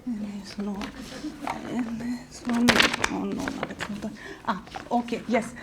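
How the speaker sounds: low, hushed searching mumble; muttering to oneself. Acted. Searching for police forms mid-call, muttering to oneself. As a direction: talk softly to yourself while hunting.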